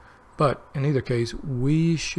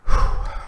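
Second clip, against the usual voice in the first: long heavy unvoiced sigh; loud tired exhale; 12 dB louder than usual. Real, not acted. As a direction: let out a big weary sigh. Tired, about to sleep.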